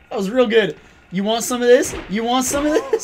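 loud laughing taunt. Acted. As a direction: taunt the enemy gleefully. Taunting enemy while laughing.

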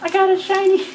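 gasp, then high excited announcement; thrilled gasp at a rare pull; gasping excitement. Real, not acted. Pulling a shiny card among friends, gasping excitement.

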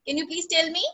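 ordinary talk, nothing marked

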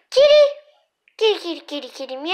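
high loud cat call. Real, not acted. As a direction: sing out loudly to the kitten. Calling kitten loudly.

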